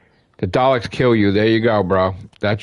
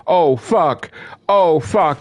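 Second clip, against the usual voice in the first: thrilled exclamation; 8 semitones higher, slower than usual. Real, not acted. Excited fan imagining destruction.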